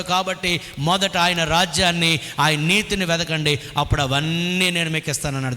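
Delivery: ordinary talk, nothing marked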